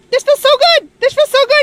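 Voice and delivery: excited relief. Real, not acted. Getting into cold bath.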